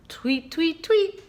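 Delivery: chirpy tweets rising high; bird tweets. Shown as a demonstration. Librarian chirps for children like a songbird. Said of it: "Open your beak and sing".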